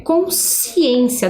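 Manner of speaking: consciência drawn out slowly, sc blended into one sound; slow demonstration. Shown as a demonstration. Teacher showing pupils the digraph, slow and clear. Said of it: "percebam que é um som único".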